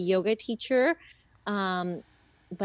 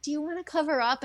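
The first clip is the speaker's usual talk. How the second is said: nurse; 7 semitones higher, faster than usual. Retold or imitated. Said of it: "my nurse was trying to be like".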